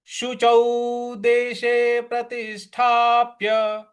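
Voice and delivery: slow, measured chanting of scripture; Sanskrit verse recitation. Acted. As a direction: recite each word with devotion.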